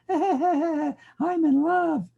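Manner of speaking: dreamy and giggling, past caring; lovesick student laughing. Acted. Student laughing off the flunk warning, dreamy swoon.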